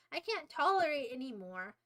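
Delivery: ordinary talk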